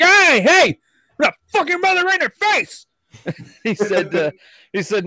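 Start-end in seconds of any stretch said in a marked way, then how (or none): 0.0-2.9 high, loud yelled threat; mock furious yell. Retelling a buddy's outburst for friends, mock furious yell. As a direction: go over the top with the outburst. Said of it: "He's like".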